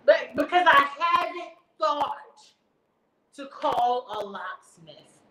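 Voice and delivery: "haven't thought to call a locksmith" spelled out slowly, word by word; slow sarcastic emphasis. Real, not acted. Mocking the operator's locksmith advice, slow sarcastic drawl. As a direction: drag it out slowly to mock her.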